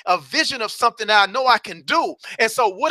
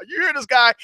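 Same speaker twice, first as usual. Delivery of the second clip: usual speaking voice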